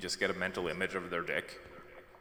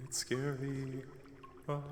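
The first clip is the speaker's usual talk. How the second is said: slow, high, mock spooky voice; 6 semitones higher, 4 dB quieter, slower than usual. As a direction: drag it out like a ghost story. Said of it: "Oh, spooky dark water".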